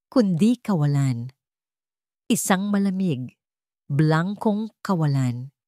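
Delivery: usual speaking voice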